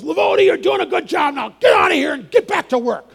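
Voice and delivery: high, loud mock foreman shouting at Mike; foreman's bark. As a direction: bark it out like a gruff boss sending him back to work. Foreman surprises Mike with bonus.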